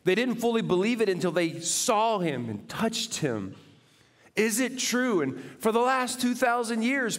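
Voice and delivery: high, loud, fervent preaching; full-force preaching. Acted. Pastor preaching to the congregation, fervent and loud. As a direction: preach it out to the whole room.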